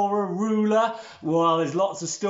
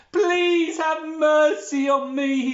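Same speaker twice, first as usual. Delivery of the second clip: high, loud-ish, drawn-out mock plea; begging for mercy; 10 semitones higher, 5 dB louder, slower than usual. Servant on his knees before the king, hammed-up begging. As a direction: plead theatrically, stretching the words. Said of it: "he begged the king".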